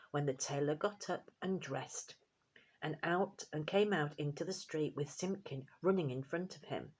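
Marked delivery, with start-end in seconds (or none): none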